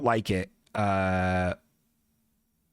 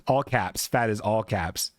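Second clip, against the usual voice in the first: usual speaking voice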